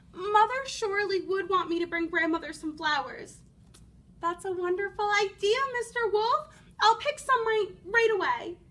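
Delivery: put-on delighted child jumping at the idea; eager little girl.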